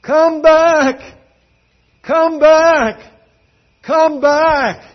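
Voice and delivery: full-voiced high shout; big, ringing imitation of a calling crowd; retold crowd shouting. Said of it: "they were shouting".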